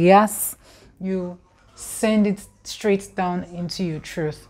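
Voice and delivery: normal talking voice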